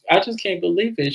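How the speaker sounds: high-pitched mock tearful disbelief; mock crying. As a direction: imitate a high, whiny lament. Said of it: "Letitia is crying".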